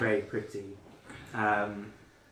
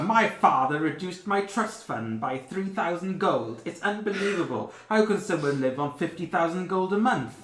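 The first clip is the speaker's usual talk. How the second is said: spoiled rich whine; 8 semitones higher, 10 dB louder than usual. Retold or imitated. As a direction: moan like losing a fortune. Spoiled rich complainer quoted.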